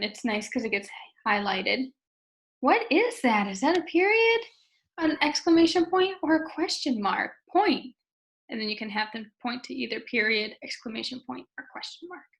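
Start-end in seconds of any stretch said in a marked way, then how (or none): 2.6-7.9 higher, louder teacher questioning; prompting a student. Shown as a demonstration. Teacher showing a student the choices, prompting brightly. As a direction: prompt a young student with lifted, emphatic questions. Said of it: "you can feel free to show them".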